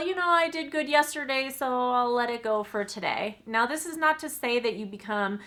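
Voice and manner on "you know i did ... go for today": lackadaisical excuse. Retold or imitated. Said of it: "being sort of lackadaisical about like".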